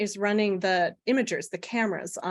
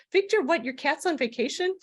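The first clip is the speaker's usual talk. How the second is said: high-pitched incredulous questioning; baffled, voice pitched well up; retold disbelief; 7 semitones higher than usual. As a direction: act out the friend's astonished double-check. Retold translator's disbelief at the news.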